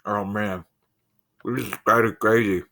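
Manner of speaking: normal talking voice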